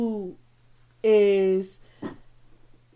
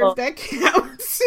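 laughing; much slower than usual. Real, not acted. Laughing joke about wine.

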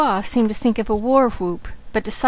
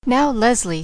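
plain reading voice

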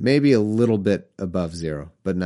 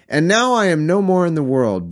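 solemn scripture reading; 7 semitones higher, 4 dB louder than usual. Acted. Reading Jesus's prayer aloud, solemn scripture voice. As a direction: intone it like a preacher.

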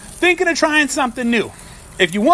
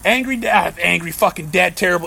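ordinary talk

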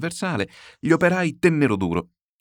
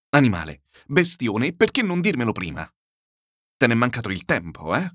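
angry master berating his servant; furious scolding. A narrator's reading. Master scolds servant who never warned him, furious. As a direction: scold sharply, with an indignant 'eh?' at the end. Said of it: "Montò naturalmente su tutte le furie".

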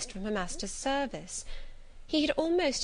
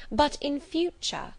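"But in future" slow and higher, heavily stressed; marked stress; 7 semitones higher, slower than usual. A narrator's reading. Warning the prying servant, "But in future" slow with marked stress. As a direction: lean hard on "But in future", drawing it out. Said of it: "I laid marked stress on the words".

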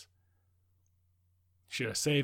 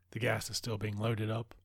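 ordinary talk, nothing marked

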